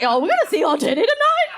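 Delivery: mock the pervy guy in the crowd; hammed-up creep voice; leering guy. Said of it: "He's like".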